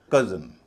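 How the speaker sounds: Cousin with the first syllable कज़ punched loud; stress on first syllable. Shown as a demonstration. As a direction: hit the कज़ in cousin hard. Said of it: "उस पर जोर दिया जाएगा". (Cousin में पहले हिस्से पर जोर)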